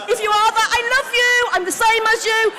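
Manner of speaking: high, loud shout to the crowd; calling out. Real, not acted. Comic reassuring cocaine addicts in the audience, calling out. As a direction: holler it affectionately over the room.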